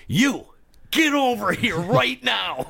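high, slow, stern mock principal; hammed-up headmaster calling a kid out. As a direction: summon the kid like a school principal. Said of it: "like the principal".

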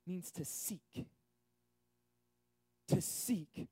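normal talking voice

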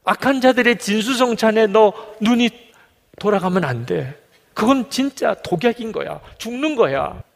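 lightly acted urgency, pitched high; urgent warning. Preacher as God warns David off evildoers' feasts, high urgent warning.

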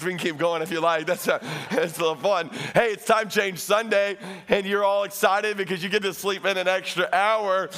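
ordinary talk, nothing marked

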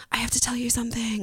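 whispered secret. Retold or imitated. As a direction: lean in and whisper it. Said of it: "my friend was like".

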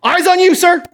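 high, loud group reply "Eyes on you, sir!"; class shout back. Retold or imitated. Karate students answering their instructor, loud shouted reply. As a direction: answer like a drilled class of kids. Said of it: "what the whole class would say".

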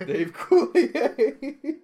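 high, slow laughing squeal. Real, not acted. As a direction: squeal the name through laughter.

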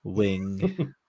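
lightly put-on British accent, detective voice; hint of a British detective introducing himself. Introducing a silly British detective character, light British accent. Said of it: "British detective".

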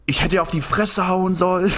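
high-pitched mock rant; young man ranting at the door, high mock anger; imitated tantrum. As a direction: ham up a shirtless guy throwing a fit. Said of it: "macht halt ein Affentheater".

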